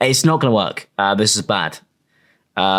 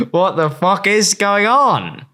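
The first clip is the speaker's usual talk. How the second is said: bewildered; 8 semitones higher, slower than usual. Real, not acted. Bewildered outburst.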